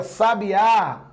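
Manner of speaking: sabiá, stressed on the final Á; stress on last syllable. Shown as a demonstration. Teacher contrasting same letters for students, stress on last syllable. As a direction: land on the end, sa-bi-Á. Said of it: "colocar um acento gráfico aqui".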